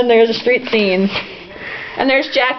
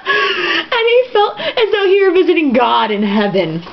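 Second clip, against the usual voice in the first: slow, hammed-up heavenly awe, higher; mock awe; 7 semitones higher than usual. Reader swooning over the book, mock rapture. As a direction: read it as if swooning.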